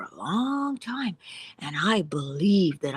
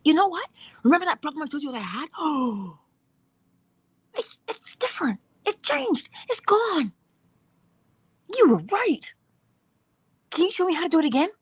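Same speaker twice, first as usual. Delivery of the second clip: much higher, near tears with joy, racing at the start; overjoyed friend; 11 semitones higher than usual. Pretend. A friend telling you their problem vanished, overjoyed and tearful. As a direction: gush like someone whose problem just vanished.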